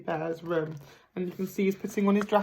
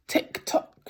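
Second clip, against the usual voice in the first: ticking. Acted. Clock noise.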